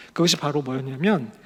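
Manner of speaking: usual speaking voice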